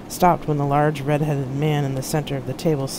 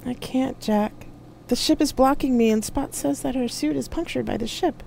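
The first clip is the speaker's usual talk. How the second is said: great sadness; 10 semitones higher than usual. A narrator's reading. Loki telling Jack the rescue is impossible, great sadness. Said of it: "with great sadness in his voice".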